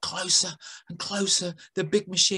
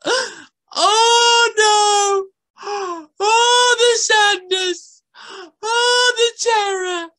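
high, loud mock wailing; girl squirrel wailing over the lost boy; hammed-up despair; 7 dB louder, much slower than usual. A narrator's reading. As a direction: overact the tragedy. Girl's melodramatic lament.

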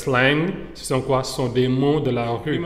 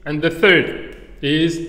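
ordinary talk

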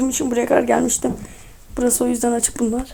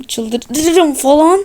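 very stretched, climbing steeply in pitch; slow, swelling rise; mounting exasperation; 3 semitones higher, 3 dB louder, much slower than usual. Real, not acted. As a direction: drag it out and let it rise into a near-crazed peak. Threatens to go crazy.

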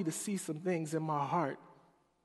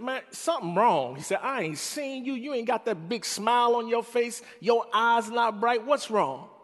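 higher, louder big-guy voice; loud concerned friend; 8 semitones higher, 8 dB louder than usual. Retold or imitated. George notices his sad face, loud and concerned. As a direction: boom the concern like a giant.

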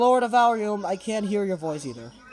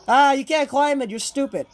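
loud, higher taunt at a pursuer; gloating; 5 semitones higher, 6 dB louder than usual. Real, not acted. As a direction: crow it in triumph. Taunts monster that cannot climb.

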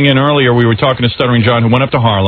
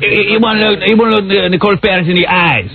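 higher-pitched mock Puerto Rican accent; 7 semitones higher than usual. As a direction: redo the shaky Puerto Rican impression, stumbling over the start. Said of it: "What kind of an accent is that?".

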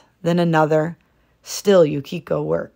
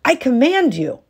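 higher, louder command; stern command; 8 semitones higher, 5 dB louder than usual. A narrator's reading. Husband ordering his wife to weave, loud command. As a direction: lay down the order. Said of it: "his voice growing loud".

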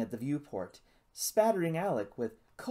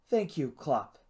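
ordinary delivery

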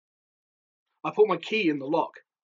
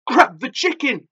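high, loud shrieked order; urgent high-pitched yell; frantic shriek; 7 semitones higher, 8 dB louder than usual. A narrator's reading. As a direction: shriek it in a panic. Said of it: "she shrieked".

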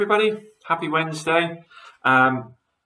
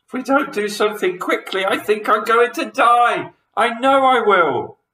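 high, frantic wail; panicked; 12 semitones higher, 5 dB louder than usual. A narrator's reading. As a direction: wail as if doomed. Missus Twit panics upside down.

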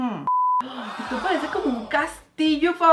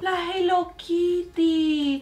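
high, slow sweet coo; cooing delight; 6 semitones higher, much slower than usual. Real, not acted. Seeing Hello Kitty pizza, a high slow coo.

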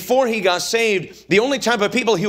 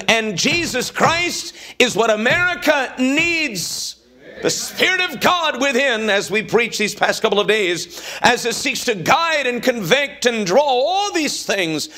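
preaching; 5 semitones higher than usual. Real, not acted. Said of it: "preaching climax, music".

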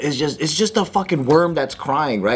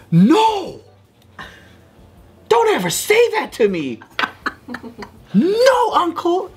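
mock toddler tantrum; 12 semitones higher than usual. Uncle imitating his angry niece, high mock tantrum yell. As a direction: yell it like an offended little girl.

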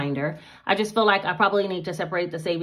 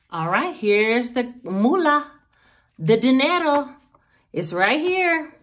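playful cash reveal; 5 semitones higher, 4 dB louder, slower than usual. Real, not acted. Showing off the cash.

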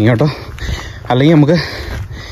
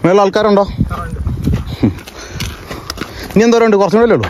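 high, raised voice calling uphill; 6 semitones higher than usual. Real, not acted. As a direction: call up to people further up the trail. Calling up to hikers above. (വിളിച്ചു ചോദിക്കുന്നു)

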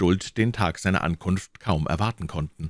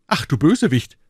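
louder and higher, indignant exclamation; outraged cry; 9 semitones higher, 7 dB louder than usual. Acted. Citizens cry out at the betraying sage, loud outrage. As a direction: cry out at the traitor, much higher. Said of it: "riefen die Schildbürger aus".

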